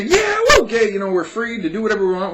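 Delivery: high, loud hammed-up celebration, settling down; sarcastic shout of joy; mock exuberant cheer. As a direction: play an overjoyed parade marcher. Mocking a pride parade chant.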